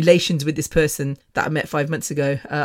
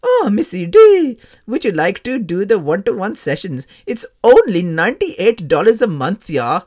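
exaggerated loud sales greeting; mock app salesperson; 5 dB louder than usual. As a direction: imitate a pushy yoga tutor. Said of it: "As soon as I get onto this bloody app".

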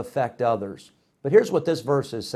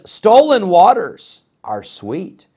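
tempting voice; 3 semitones higher, 8 dB louder than usual. Retold or imitated. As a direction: savour the forbidden. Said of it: "she says".

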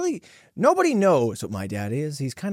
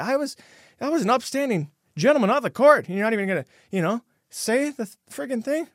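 offended saint; 8 semitones higher than usual. Retold or imitated. Voices offended Saint Patrick.

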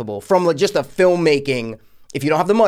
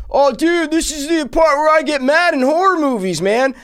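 high, put-on dopey dude voice, a bit louder; mock the movie guys in a high silly voice; mocking the characters; 13 semitones higher, 5 dB louder than usual. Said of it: "the guys are like".

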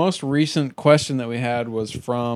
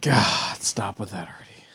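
annoyed whisper; 4 semitones lower, 4 dB quieter than usual. Real, not acted. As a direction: hiss it under your breath.

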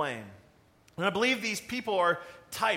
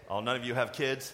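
normal talking voice

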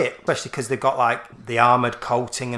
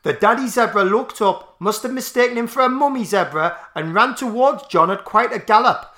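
higher-pitched mock Geordie testimony; wife's Geordie accent; 7 semitones higher, 3 dB louder than usual. Wife describing the zebra's charge, imitated Geordie accent. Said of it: "even Doreen has said it here".

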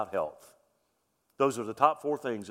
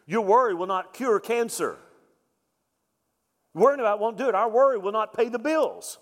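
preaching louder and higher, building to a peak; rising preaching; 6 semitones higher, 4 dB louder than usual. Acted. Pastor to congregation, building to a fervent preach. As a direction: drive it home with mounting force.

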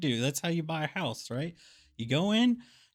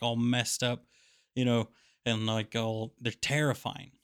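ordinary talk, nothing marked

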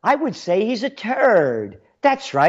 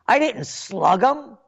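mock defensive voice; 5 semitones higher than usual. Mock excuse of Tanden.

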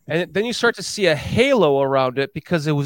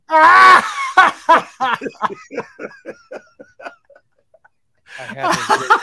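laughing shout; 6 dB louder than usual. Real, not acted. Host laughing while shouting.

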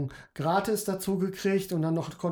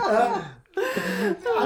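high burst of real laughter; loud laugh; 3 dB louder than usual. Real, not acted. Laughs at the drooling joke.

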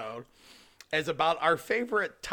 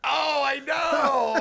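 drawn-out shout; 10 semitones higher, 5 dB louder, much slower than usual. Real, not acted. Shouted reaction, laughing.

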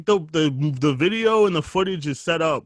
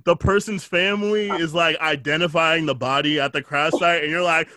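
ordinary talk, nothing marked

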